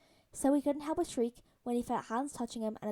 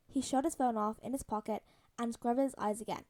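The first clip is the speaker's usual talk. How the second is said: usual speaking voice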